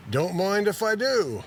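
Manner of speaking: high-pitched, hammed-up British accent; cartoonish British lilt, swooping up. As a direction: accept gleefully in a badly faked British voice. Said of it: "to show Keith Moon's British accent".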